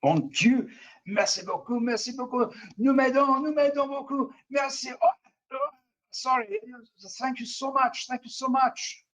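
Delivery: put-on high-pitched, gushing French Canadian accent. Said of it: "French Canadian, uh, voice".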